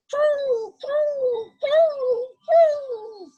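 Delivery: high, loud cooing like a pigeon. A narrator's reading. Reading to children, imitating the pigeons: high, loud coo.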